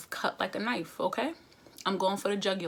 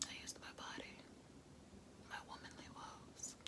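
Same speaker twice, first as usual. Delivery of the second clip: whispered confession, much softer; secret whisper; 24 dB quieter than usual. Real, not acted. Admitting to the viewers how she wins him back, whispering. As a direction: let them in on the secret in a whisper. Confessing her trick as an aside.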